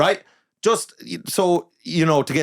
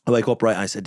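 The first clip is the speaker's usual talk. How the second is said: usual speaking voice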